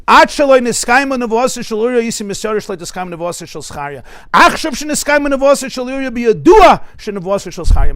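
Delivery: loud, high-pitched recitation of scripture; ringing preacher's quotation; impassioned declamation. Acted. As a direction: declaim the Hebrew with mounting force. Declaims Rabbi Akiva's final words.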